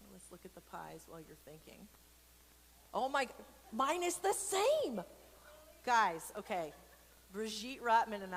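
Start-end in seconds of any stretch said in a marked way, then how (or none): 2.9-5.0 slow, high, louder delight; thrilled to share the same favorite; excited. Real, not acted. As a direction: gush over the pie. Excited about favorite pie.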